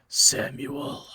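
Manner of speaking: whispered, soft zombie rasp. Acted. Zombie calling the painter by name, whispered zombie voice. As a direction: croak it like the undead. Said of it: "like, a zombie voice".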